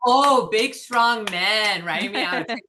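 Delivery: big mocking cheer; sarcastic applause. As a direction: jeer at them with fake praise. I'm just clapping.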